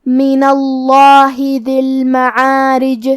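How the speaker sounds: slow, drawn-out tajweed chant; Quran recitation. Acted. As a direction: recite the verses slowly and melodically. (قرآن کی تلاوت، آہستہ اور لمبی)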